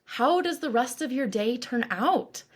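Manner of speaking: ordinary delivery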